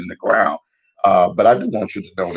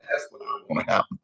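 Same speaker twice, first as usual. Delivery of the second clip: whispered wish; 8 dB quieter than usual. Real, not acted. Vlogger whispers his hope to see the president in cuffs. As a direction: confide it like a secret wish.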